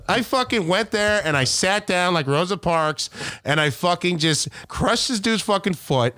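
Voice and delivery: ordinary talk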